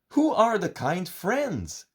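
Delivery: high, bright little-creature question; Goody voice. A narrator's reading. Goody asking Gooda about the letter, in a high little voice. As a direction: lift it up like a curious small friend. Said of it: "asks Goody".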